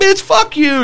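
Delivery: high-pitched mock yell; retold angry retort. Hothead passenger cursing out guys in the next car, retold angry retort. Retold shouting match between cars.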